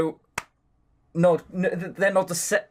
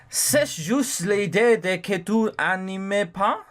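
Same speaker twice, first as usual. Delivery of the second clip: put-on French accent; 3 semitones higher, slower than usual. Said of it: "I do the ridiculous accent".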